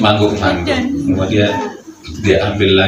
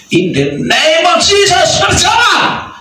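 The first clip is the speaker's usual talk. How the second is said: much higher, loud healing command; commanding shout; 4 dB louder than usual. Acted. Petrus menyembuhkan orang lumpuh. (Petrus berseru memerintahkan orang lumpuh berjalan)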